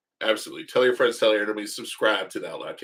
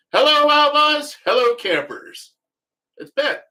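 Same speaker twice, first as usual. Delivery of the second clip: very high, slow, loud mock announcer hello; playful show-host toast, pitched way up and stretched; hammed-up greeting; 8 dB louder, slower than usual. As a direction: sing out the welcome like a goofy host.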